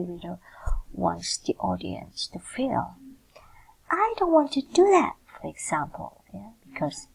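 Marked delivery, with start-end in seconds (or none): 3.9-5.2 high, loud screamed refusal; demo scream. Shown as a demonstration. Teacher shows students how to scream the line. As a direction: shriek it like a child refusing. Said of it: "you scream".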